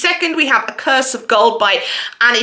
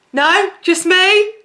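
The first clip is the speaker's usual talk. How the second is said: playful; 8 semitones higher, slower than usual. Real, not acted. Playful aside to viewers.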